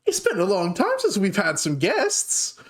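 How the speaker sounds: put-on high-pitched young dwarf greeting; excited young dwarf girl. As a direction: burst out with a high, eager welcome.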